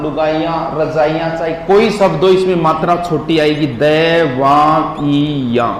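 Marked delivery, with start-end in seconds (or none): 3.8-5.7 दैवाइया drawn out very slowly; teacher slowly models दैवाइया; slow spelling-out. Shown as a demonstration. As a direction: stretch दैवाइया slowly for the class. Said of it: "ऐसे लिखो इसको".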